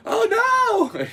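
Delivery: sudden high, loud cry of alarm; mock panic. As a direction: yelp it like it just went wrong. Acting out vacuum mishap.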